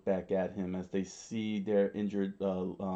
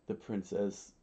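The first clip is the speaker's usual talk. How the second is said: plain reading voice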